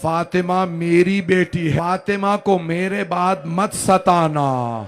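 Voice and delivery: loud, slow, proclaiming to a crowd; loud, slow plea to the believers; impassioned public plea. Acted. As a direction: call out to the whole nation, pleading. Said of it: "बीबी उम्मत से कह रही थी".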